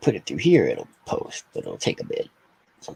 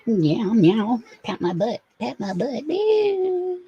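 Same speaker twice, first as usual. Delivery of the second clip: much higher cutesy cat voice with meows; voicing the cat; 12 semitones higher, much slower than usual. Owner speaks for her cat in a much higher cutesy voice. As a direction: speak for the cat, squeaky and pleading.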